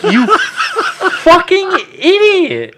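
high, drawn-out exasperated outburst; exasperated insult. Retold or imitated. Berating Keith over the slides, exasperated insult. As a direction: stretch out the insult in retold disbelief.

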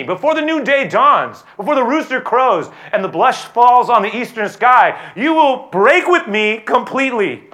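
high and loud, insistent warning; forceful rebuke. Acted. Jesus warning Peter of his denial, loud rebuke. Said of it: "And he says".

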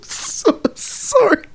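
very high, slow, breathless giggly words; real giggling fit; helpless laughter. Real, not acted. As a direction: squeeze out an apology through laughter.

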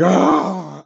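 high, sharp cry of alarm at the test swab; dramatic yelp. Retold or imitated. Dramatic yelp as the swab goes up his nose. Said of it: "I'm dramatic when things happen".

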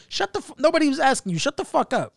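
high-pitched acted telling-off; irritated rebuke. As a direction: shut down a butt-in impatiently. Acts rebuke of an eavesdropper.

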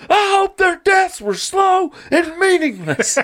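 very high, slow, sing-song cartoon hippie teacher; Mister Bussy voice. Teacher muses about the boys' deaths, in a very high, slow cartoon voice. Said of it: "And fucking Mister Bussy".

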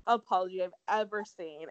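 normal talking voice

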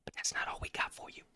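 hushed, voiceless stage whisper; whispered teaser. Pretend. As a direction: whisper it like a secret promise.

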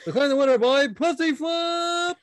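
high nasal announcer impression; Joe Martinez announcer voice. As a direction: boom it like a fight announcer. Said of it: "Can you imagine Joe Martinez on the mic".

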